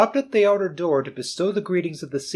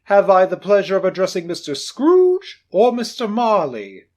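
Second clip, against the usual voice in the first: hearty gentleman; 6 semitones higher, 8 dB louder than usual. Acted. Portly gentleman greeting Scrooge, booming. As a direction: announce yourself pleasantly.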